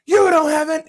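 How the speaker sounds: high, loud mocking snap; snapping worker. Host mimicking the worker snapping at him, high and loud. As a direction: fire it back like the fed-up worker. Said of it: "And she goes".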